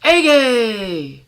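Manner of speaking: loud high shout of triumph; exclaimed. Acted. Man throwing the ball to the sky, loud exclamation. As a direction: call it out as you throw. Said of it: "he exclaimed".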